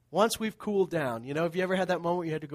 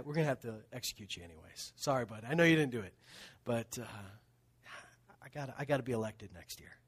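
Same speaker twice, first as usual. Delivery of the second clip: quick, softer, offhand judge aside; mock corrupt judge; 6 semitones lower, 7 dB quieter, faster than usual. Judge telling the innocent man he'll die anyway, quiet offhand mock. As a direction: toss it off like a sheepish politician.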